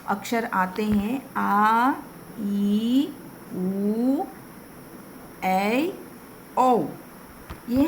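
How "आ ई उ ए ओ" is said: आ, ई, उ, ए, ओ each held long; long vowels. Shown as a demonstration. Teacher shows children the long vowels held out. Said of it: "ठीक जिसका opposite हमारा दीर्घ स्वर होता है".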